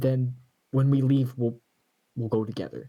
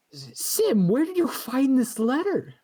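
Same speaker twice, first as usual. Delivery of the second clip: alarmed; 12 semitones higher than usual. Acted. Character alarmed by the letter.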